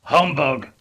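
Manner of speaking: loud, dismissive humbug; scornful humbug. A narrator's reading. Scrooge scoffing at Christmas, loud scorn. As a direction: sneer it with contempt. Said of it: "said Scrooge".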